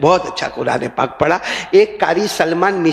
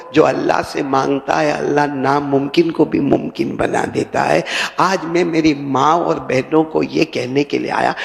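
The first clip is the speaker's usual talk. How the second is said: ordinary talk, nothing marked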